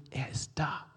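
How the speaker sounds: near-whisper, very soft; softly breathed assurance; hushed. Real, not acted. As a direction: drop into an intimate whisper. Hushed key line of sermon.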